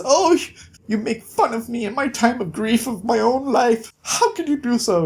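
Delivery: high, prim, wounded lady voice; hammed-up hurt weeping; mock tearful lady. Dying lady tearfully scolds Jack for mocking her.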